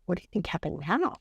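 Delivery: plain reading voice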